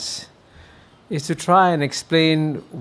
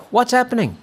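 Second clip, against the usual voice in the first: higher, urgent question to the crowd; boy pushing through a crowd asks what's happening, pitched high; asking the crowd; 11 semitones higher than usual. Retold or imitated. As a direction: call out high over the crowd. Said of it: "asking the onlookers in Malay and Hokkien dialect".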